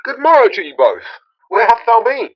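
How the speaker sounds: high, loud, cheery hail; lively loud greeting. Acted. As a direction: greet them boisterously.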